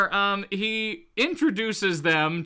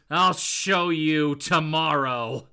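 slow, lower, menacing promise; displeased Titania; 4 semitones lower, much slower than usual. Acted. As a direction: draw the threat out, low and slow. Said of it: "is none too pleased about this and just goes".

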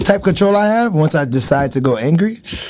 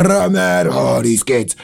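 ordinary talk

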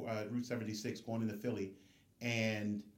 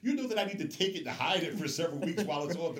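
mock amazement; 6 semitones higher, 4 dB louder, faster than usual. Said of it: "I'm looking at him like".

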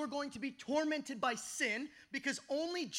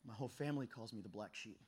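tearful; 11 semitones lower, 12 dB quieter than usual. Retold or imitated. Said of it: "And I'm just crying".

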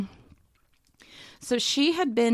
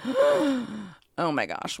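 mock overwhelmed gasp; 4 semitones higher, much slower than usual.

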